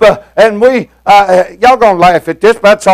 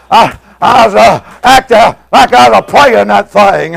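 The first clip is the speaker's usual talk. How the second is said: ordinary delivery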